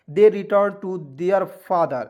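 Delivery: very slow, word by word; slow practice reading. Shown as a demonstration. Teacher slows down so students can follow, very slow. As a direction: read it out slowly for beginners to follow. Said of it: "हम चाहूँगा तो एकदम fast पढ़ लूँगा".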